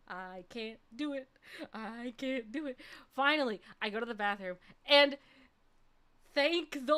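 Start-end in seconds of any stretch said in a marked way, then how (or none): 0.1-2.8 soft inner-thought voice; mumbled-to-self worry, acted lightly; retold self-doubt. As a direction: think it aloud, softer.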